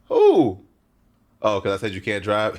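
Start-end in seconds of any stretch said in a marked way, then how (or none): 0.1-0.6 loud, high-pitched "who"; indignant challenge. Pretend. Reactor answers being called dick rider, loud mock offence.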